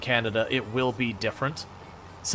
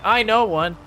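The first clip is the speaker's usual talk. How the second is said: mocking voice; 9 semitones higher, 5 dB louder than usual. Mocking token-friend claim.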